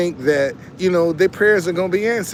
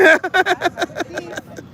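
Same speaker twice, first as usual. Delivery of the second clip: high laugh; 13 semitones higher than usual. Real, not acted. Laughs about the host.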